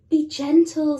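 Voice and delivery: gently voiced mother; mummy penguin. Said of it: "said his mummy".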